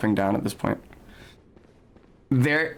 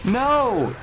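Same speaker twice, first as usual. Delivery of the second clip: startled no; 11 semitones higher than usual. Real, not acted. Horrified by screaming enemies.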